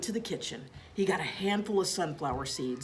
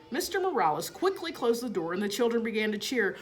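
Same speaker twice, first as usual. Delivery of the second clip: usual speaking voice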